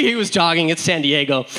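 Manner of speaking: usual speaking voice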